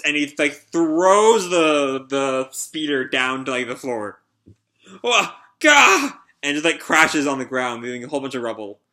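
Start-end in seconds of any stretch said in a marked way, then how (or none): 5.0-6.2 startled yelp. Acted. Robber crashes to the ground.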